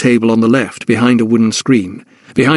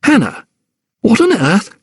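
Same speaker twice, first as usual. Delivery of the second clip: astonished; 10 semitones higher than usual. Acted. Francis recognises her astonished.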